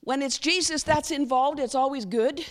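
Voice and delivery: ordinary talk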